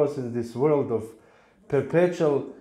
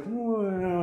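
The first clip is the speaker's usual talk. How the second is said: falling whoa; 9 semitones higher, 3 dB quieter than usual. Retold or imitated. Falling into deep well.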